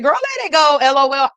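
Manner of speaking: put-on high, loud, teasing girlfriend voice; playful sass. To Brenda, mocking how her text should read, high and sassy. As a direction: toss it off like a breezy joke. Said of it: "if you was joking and saying".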